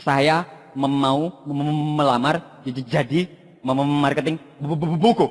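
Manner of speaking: low, subdued mimicked stammer; stuttering job applicant. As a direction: stick on the first sound of each word. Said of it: "Ada orang gagap".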